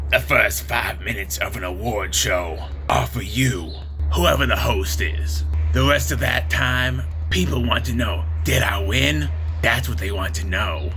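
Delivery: lightly voiced impersonation of a talk-show host; Whoopi Goldberg. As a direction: read it out in a slightly put-on voice. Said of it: "this is what Whoopi Goldberg had to say".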